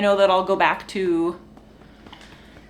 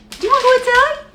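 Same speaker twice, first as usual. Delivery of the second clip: much higher, louder squeal of "Do you wanna go outside?"; squeaky pet voice; 7 dB louder, faster than usual. Real, not acted. Baby-talking the dogs.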